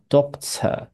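British "doctor", rounded O, silent final R. Shown as a demonstration. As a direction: model "doctor" without curling the tongue at the end. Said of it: "英国人是会念doctor". (英国腔 doctor，不卷舌)

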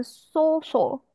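소설 with both 소 and 설 in rounded lips, as the mistake; 소설, keeping the lips round on both syllables; both vowels rounded. Shown as a demonstration. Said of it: "两个字都是嘴巴比较圆的".

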